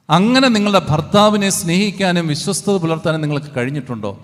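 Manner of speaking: fiery preaching. Real, not acted. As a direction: challenge the congregation with rising force. Preaching at full force. (ഉച്ചത്തിലുള്ള ആവേശപ്രസംഗം)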